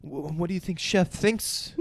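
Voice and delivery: timid stammer, put on; mock worried. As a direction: imitate an anxious diner fretting over Chef's opinion. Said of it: "he is so worried".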